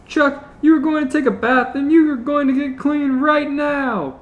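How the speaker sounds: high scolding mom voice. Acted. Panty scolding Chuck about his bath, high scold. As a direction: scold him like a naughty child. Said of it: "Panty scolded".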